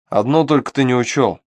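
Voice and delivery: plain reading voice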